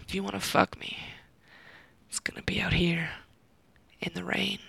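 seductive hushed whisper. Acted. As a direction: breathe it softly, insisting.

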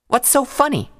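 high, rising indignation; angry. A narrator's reading. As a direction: snap back in anger. Twilight angry.

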